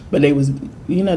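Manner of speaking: normal talking voice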